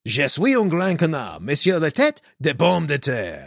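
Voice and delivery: put-on clumsy made-up French accent; proudly butcher the French; bad French. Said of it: "I'm trying to speak French".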